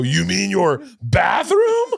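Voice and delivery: slow, shouted punchline. Retold or imitated. Punchline of the pitch.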